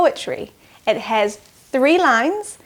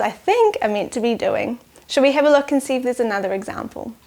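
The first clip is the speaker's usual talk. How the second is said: ordinary delivery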